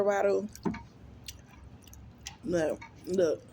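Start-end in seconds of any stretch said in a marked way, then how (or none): none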